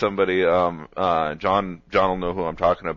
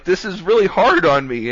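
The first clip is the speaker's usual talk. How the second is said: higher, louder mock complaint; retold indignant protest; 9 semitones higher, 6 dB louder than usual. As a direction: imitate someone upset at being laughed at. Retold friend protests being laughed at.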